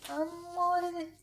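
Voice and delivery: high, slow cooing. Acted. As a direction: coo it like to a baby.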